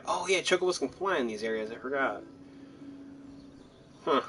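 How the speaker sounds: normal talking voice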